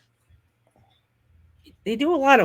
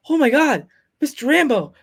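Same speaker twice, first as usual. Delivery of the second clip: high, loud excited gush with a laugh; freaking out; 7 semitones higher, 6 dB louder than usual. Retold or imitated. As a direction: starstruck fan losing it on the phone. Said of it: "I was, like, freaking out".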